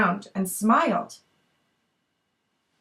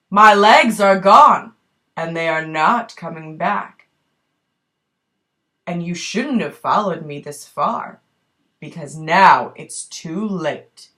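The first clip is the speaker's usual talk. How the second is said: lightly voiced menacing man; 6 semitones lower, 5 dB louder than usual. Snake man answers the girl.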